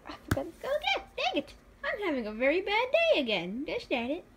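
very high, fast cartoon whine; squeaky character voice. Voicing her comic character's bad day: squeaky, fast whine. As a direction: squeak it out like a flustered little cartoon.

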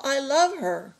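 high-pitched heartfelt protest; crying out. A narrator's reading. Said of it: "cried Miguel".

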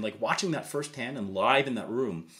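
ordinary talk

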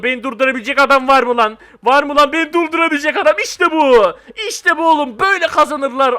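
loud, triumphant boasting; pumped up. Real, not acted. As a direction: crow it like a winner. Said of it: "çok fena gaza geldim".

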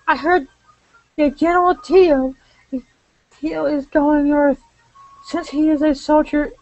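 high-pitched put-on lady's voice; Sonya's diary voice. Sonya confiding worry for her soldier husband, high put-on voice. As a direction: read the diary high, in character.

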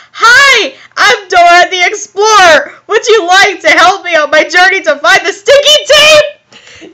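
put-on loud, high, perky little-girl voice; over-the-top children's show host; Dora the Explorer. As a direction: shout it cheerily like a cartoon kid. Young girl Dora introduced, then voiced.

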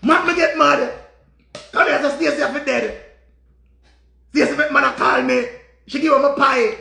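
high, loud, distraught complaint; distraught outburst. Real, not acted. Jilted man lamenting Stacy's cheating, distraught.

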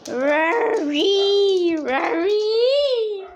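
high, long sing-out noise; drawn-out wail. Pretend. Owner making noises at pets, drawn-out wail. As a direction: wail it out high, stretching each sound.

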